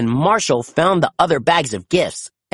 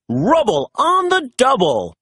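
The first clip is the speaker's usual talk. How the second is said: high, eager mock shout; mild shout; 8 semitones higher than usual. A narrator's reading. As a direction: call out the catchphrase. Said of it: "Rubble shouted".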